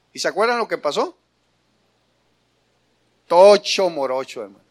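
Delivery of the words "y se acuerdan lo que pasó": usual speaking voice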